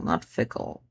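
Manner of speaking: sickle whispered with no voice. Shown as a demonstration. Poet testing the word sickle in a whisper. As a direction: breathe sickle out softly. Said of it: "It's supposed to be with a sickle".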